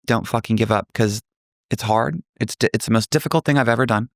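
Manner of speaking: normal talking voice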